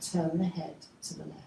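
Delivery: usual speaking voice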